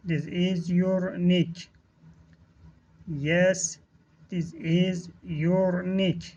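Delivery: slow, word-by-word teaching delivery; drawn-out words with small gaps between; slow model reading. Shown as a demonstration. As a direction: model the phrase slowly for learners. Teacher models phrase for learners.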